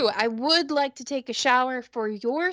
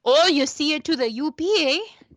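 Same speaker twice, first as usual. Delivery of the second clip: higher-pitched mock Yooper accent; Upper Midwest accent; 5 semitones higher than usual. Joking about the U P, hammed-up Upper Midwest accent. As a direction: put on a lilting Upper Peninsula accent. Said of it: "Wisconsin accent".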